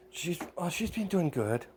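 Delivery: lightly voiced girl, pitched high; polite girl. Girl answers her boyfriend's mother about her own mother, lightly polite.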